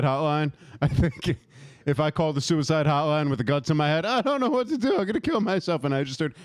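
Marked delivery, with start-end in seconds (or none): 4.0-5.8 high whiny distressed caller. Pretend. Calling the suicide hotline, high whiny plea. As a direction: plead into the phone, high and thin.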